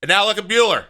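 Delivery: ordinary talk, nothing marked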